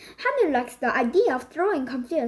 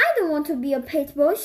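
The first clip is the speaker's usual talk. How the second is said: ordinary delivery, nothing marked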